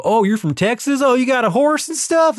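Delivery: mocking outsider. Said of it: "people go".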